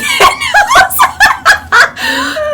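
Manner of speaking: loud, high burst of laughter; bursting out laughing. Real, not acted. Laughing at her own fight story, real loud cackle. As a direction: crack up loudly. Cracks up retelling the comeback.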